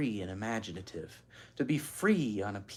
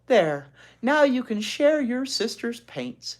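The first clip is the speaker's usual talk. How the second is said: high, slow, soothing teacher; kindly teacher; 10 semitones higher, 6 dB louder than usual. Acted. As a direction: settle the child gently. Teacher settles Ramona.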